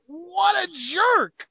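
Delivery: high, drawn-out indignation; indignant outburst. Real, not acted. Co-host reacting to wrestler's snub, indignant. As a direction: stretch it out, appalled, voice shooting up.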